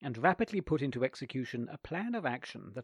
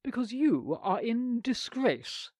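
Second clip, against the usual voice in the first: slow, higher, haughty aunt; lofty; 9 semitones higher, slower than usual. A narrator's reading. Aunt refuses the boy, slow and lofty. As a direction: look down on him from a height, slowly. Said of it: "said the aunt loftily".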